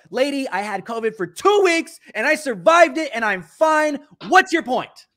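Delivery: high, loud retold defiance; emphatic, exasperated retort; heated comeback. Acted comeback in the quarrel.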